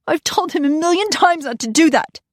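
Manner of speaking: high, fretful exasperation; exasperated. Acted. Mother about her son, exasperated. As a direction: vent worry as scolding.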